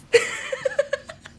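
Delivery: high burst of laughter; real laughing fit. Real, not acted. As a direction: crack up after showing off. Cracks up after the demo.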